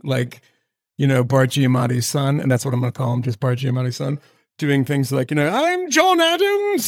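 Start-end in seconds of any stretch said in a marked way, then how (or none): 5.5-6.9 put-on period accent, high and drawn out; mock early American accent. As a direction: stretch it out in a high, hammed-up colonial accent. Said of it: "you know, that kind of accent".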